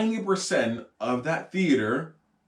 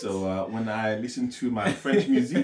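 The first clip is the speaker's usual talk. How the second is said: low, unhurried fake French accent; mock French accent; 3 semitones lower than usual. Claiming to be French for laughs, mock French accent. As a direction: play a proud Frenchman with a put-on accent. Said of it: "I am French".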